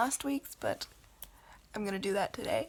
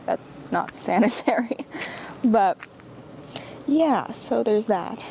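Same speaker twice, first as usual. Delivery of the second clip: wearing a retainer; 8 dB louder, slower than usual. Real, not acted. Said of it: "now I have to wear it around all day".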